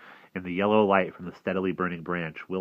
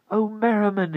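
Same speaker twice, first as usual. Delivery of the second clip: high, loud unhappy cry; unhappy plea; 8 dB louder than usual. A narrator's reading. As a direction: call out miserably, pitched high. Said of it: "he thought unhappily".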